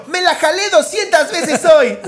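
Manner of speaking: put-on high, slow, excited ad kid; infomercial kid. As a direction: gush like a kid in a commercial. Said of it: "Y el niño".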